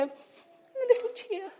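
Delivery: tiny, high, soft mock whimper; mock-pretty little weep; dainty movie crying. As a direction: squeak it out like a delicate film-star sniffle. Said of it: "not the sort you see in the movies where they go".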